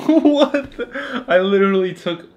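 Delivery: high laughing disbelief. Real, not acted. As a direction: laugh out the question. Laughing at own sleep clip.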